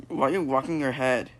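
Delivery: ordinary talk, nothing marked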